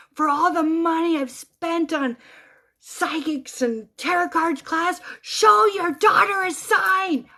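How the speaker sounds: loud, high scream, stretched slow; screaming inwardly. Retold or imitated. Widow demanding a sign from late husband, acted-out scream. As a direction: build to a drawn-out, pleading yell. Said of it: "I just started screaming in my head".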